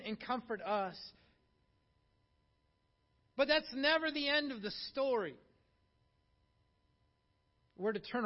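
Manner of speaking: loud, high, ringing sermon delivery; full-force preaching. Acted. Pastor urging the congregation to comfort others, preaching loud. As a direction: preach it out to the whole church.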